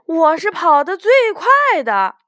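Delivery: high, loud, triumphant crowing; proud boast. Acted. Race winner bragging to the big rabbit, proud boast. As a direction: crow about winning, pitched well up. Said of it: "他突然觉得好得意".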